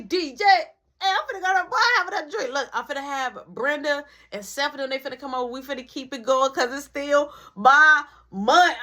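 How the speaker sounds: high-pitched, excited party announcement; tipsy mom. Retold or imitated. Mom tells her kid about the night's party, tipsy. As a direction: imitate a lit mother announcing her plans. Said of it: "My mom lit".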